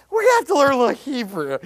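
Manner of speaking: quick, high, loud kiddie-teacher mimicry; mock him chirping to toddlers; Jim Shetler. Preacher mocking a colleague's Hebrew lesson, mock talking-to-toddlers voice. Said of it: "he's like".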